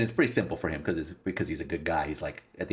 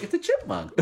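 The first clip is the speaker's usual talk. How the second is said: put-on high-pitched little girl's discovery; playful high child voice; imitated child; 11 semitones higher than usual. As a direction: pipe up like an excited kid.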